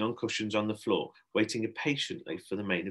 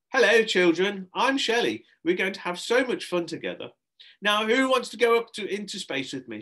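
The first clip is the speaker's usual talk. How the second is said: bubbly hostess; 10 semitones higher, 4 dB louder than usual. A narrator's reading. Astronaut hostess greets children.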